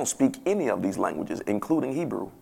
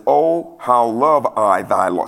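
slow, louder archaic scripture recital; King James style; 4 dB louder, much slower than usual. Shown as a demonstration. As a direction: draw out the old-fashioned verse, pitched up on OH. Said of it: "See, that's that King James".

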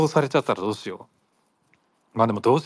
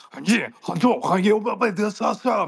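fast, higher fake Korean-sounding babble; mock rapid gibberish; 9 semitones higher than usual. Captor rattles gibberish at her, mock foreign babble. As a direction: rattle off nonsense like a shouting captor. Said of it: "ずっと早口でさ、でけえ声でさ".

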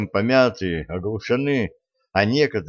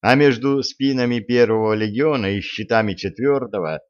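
ordinary delivery, nothing marked

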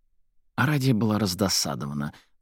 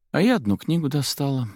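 higher-pitched, offhand remark; lightly casual, pitched up; feigned casualness; 7 semitones higher than usual. A narrator's reading. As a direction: drop it in as if by chance. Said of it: "сказала Арадия как бы невзначай".

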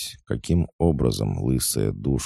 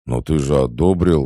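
louder, higher aggrieved objection; indignant protest; 4 semitones higher, 6 dB louder than usual. A narrator's reading. Scolded brother reminds him of his approval, indignant. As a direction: object as if wrongly blamed. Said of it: "возмутился брат".

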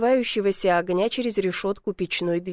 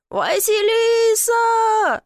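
long, high, loud call; muffled yell; 12 semitones higher, 5 dB louder, much slower than usual. A narrator's reading. Man stuck in a chimney calling her, muffled yell. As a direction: holler for help, stretching the name. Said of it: "заорал приглушённо".

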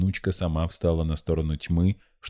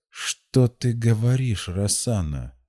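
slow, higher-pitched reproving question; stern; 6 semitones higher, slower than usual. A narrator's reading. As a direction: reprove a granddaughter slowly and sternly. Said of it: "обратил строгий взор на внучку и произнёс".

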